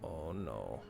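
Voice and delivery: ordinary delivery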